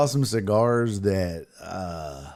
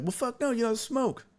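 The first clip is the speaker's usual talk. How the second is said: high, cocky refusal; jokingly turning down the podcast hosts; mock defiance; 12 semitones higher, faster than usual. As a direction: brush them off with a laugh. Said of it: "And I was like".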